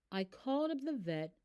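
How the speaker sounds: ordinary delivery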